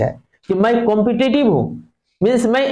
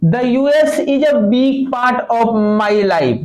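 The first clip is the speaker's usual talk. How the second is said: slow reading; 3 semitones higher, much slower than usual. Shown as a demonstration. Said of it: "teacher reading text slowly".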